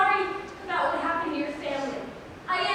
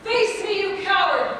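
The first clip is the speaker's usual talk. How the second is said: loud, higher battle challenge; challenging yell; 3 semitones higher, 6 dB louder than usual. Acted. Orlok bursting back on stage to challenge Piero, loud. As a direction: call him out to fight. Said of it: "yelling and waving his sword".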